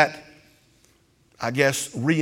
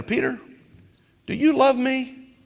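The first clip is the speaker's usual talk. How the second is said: pleading question; 10 semitones higher than usual. Retold or imitated. Jesus asks again, pleading higher.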